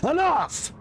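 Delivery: sharp high shout. Acted. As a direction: cut him off with a yell. Player snaps at clerk.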